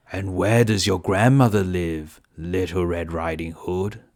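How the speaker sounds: wolf voice. Acted. Wolf questioning the girl, low and sly. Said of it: "said the wolf".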